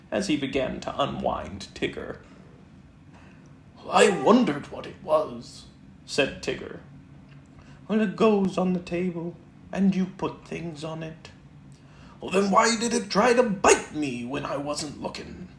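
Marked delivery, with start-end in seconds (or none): 3.9-5.6 lightly voiced bouncy Tigger; a hint of excited Tigger at the mirror. 7.9-15.5 lightly voiced bouncy Tigger; a hint of excited Tigger at the mirror.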